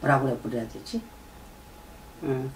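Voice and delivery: normal talking voice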